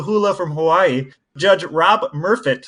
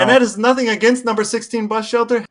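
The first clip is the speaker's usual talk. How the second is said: laughing; 7 semitones higher, 3 dB louder than usual. Real, not acted. Laughing at the absurd name.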